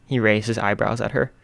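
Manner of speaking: ordinary delivery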